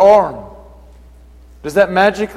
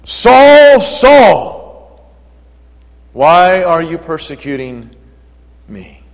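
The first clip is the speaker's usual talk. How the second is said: voice of the Lord; 5 dB louder, much slower than usual. Acted. The Lord calling to Saul on the road, booming. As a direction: call out as the Lord from heaven. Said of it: "heard a voice saying to him".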